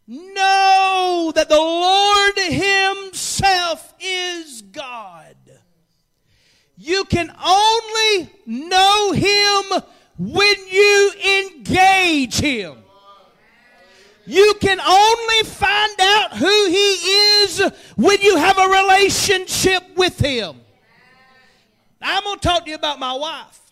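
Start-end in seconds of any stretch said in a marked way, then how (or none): 0.1-5.4 "Lord" shouted, very slow and drawn; preached shout. Shown as a demonstration. Pastor proclaiming the psalm to the church, slow shout. 6.8-20.6 loud, slow, higher sermon cadence; preaching. Acted. Pastor pressing the church to know God, preaching loud.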